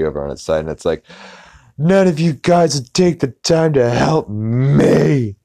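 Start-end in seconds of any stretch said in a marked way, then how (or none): 1.8-5.4 high, slow, put-upon tree voice; whiny fallen tree. Acted. Fallen tree whining to the other trees, high and slow. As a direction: drag out the self-pity like a sulking log.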